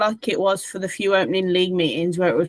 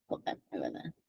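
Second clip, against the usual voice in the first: whispering; 19 dB quieter, faster than usual. Real, not acted. Aside off mic.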